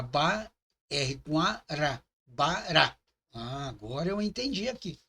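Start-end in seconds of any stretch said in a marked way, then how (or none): 0.0-3.0 ba and ra spelled apart, then bará slowly; sounding out words. Shown as a demonstration. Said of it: "B com A".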